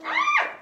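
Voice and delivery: very high, loud shriek; startled scream. Real, not acted. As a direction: let out a real shriek of fright. Startled while trapping the bee.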